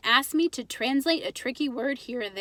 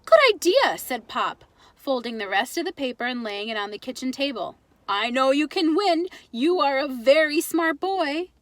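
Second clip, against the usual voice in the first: encouraging Pop; 4 dB louder than usual. Acted. Father encouraging his son to enter the contest. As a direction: reassure the boy warmly.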